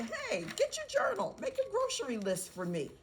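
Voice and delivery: parent calling out playfully, pitched high; parent to child. Acted. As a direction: call your child to a small task, high and lively. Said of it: "You can have them say".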